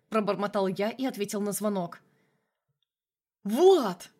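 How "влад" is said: loud, high furious yell of his name; furious shout. A narrator's reading. Angry colonel shouts at Vlad over the phone, furious yell. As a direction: bellow the name in a rage. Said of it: "прокричал взбешённый коп".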